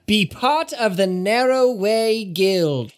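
high, sneering critic voice; pompous art critic scoffing; snobby critic. Acted. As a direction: look down your nose and jeer.

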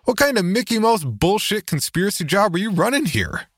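high, indignant demand; angry outburst. Acted. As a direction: fire off an incredulous, angry question.

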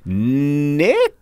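"Nick" stretched out, rising high; hesitant name guess. Acted. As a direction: draw out "Nick" as an unsure question.

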